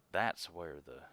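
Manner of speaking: normal talking voice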